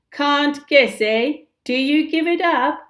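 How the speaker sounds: high, loud, gloating taunt; crowing. Acted. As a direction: crow it in triumph. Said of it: "Peter began to crow".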